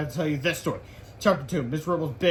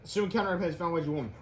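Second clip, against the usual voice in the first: ordinary delivery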